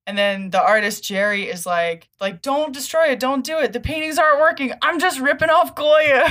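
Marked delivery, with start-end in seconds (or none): 2.4-6.2 high, loud, fast pleading; frantic begging. Retold or imitated. Artist begging Hellboy not to destroy the sculpture, frantic. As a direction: beg him desperately to stop.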